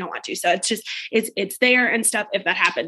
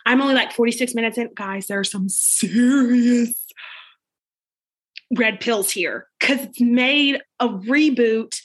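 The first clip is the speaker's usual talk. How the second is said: ordinary delivery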